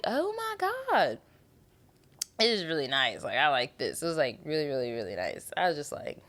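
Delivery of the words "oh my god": awed. Retold or imitated. Awed reaction to Agatha.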